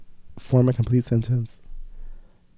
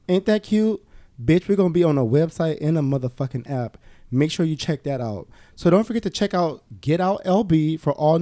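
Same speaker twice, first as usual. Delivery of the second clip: upbeat, higher sponsor-read voice; 5 semitones higher than usual. Acted. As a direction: sell it like a bright promo spot, a notch higher. Host reads a sponsor ad.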